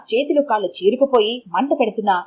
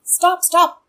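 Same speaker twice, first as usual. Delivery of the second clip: calling out; 8 semitones higher than usual. A narrator's reading. Waving down a passing car.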